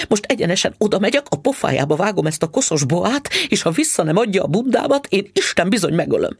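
fast, high, furious inner rant; wife swears revenge on the pawnbroker, fast and furious; fuming to herself. A narrator's reading. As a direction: race through the threats in a rising fury. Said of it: "mondta magában".

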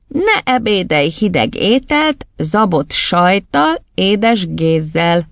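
reciting a rhyme. Acted. Boy reciting his spelling rhyme, slow singsong. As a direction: draw out each phrase of the verse. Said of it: "azzal belekezdett a mondókába".

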